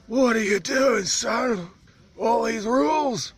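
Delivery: mock his exasperated grumble; imitating the islander.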